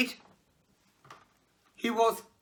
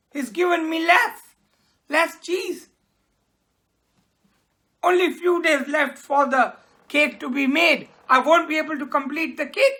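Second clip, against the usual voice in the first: higher, louder worried complaint; upset baker; 6 semitones higher, 4 dB louder than usual. Acted. Baker complaining to Jim about short cheese. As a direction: fret that the cake is ruined. Baker angry, cake ruined.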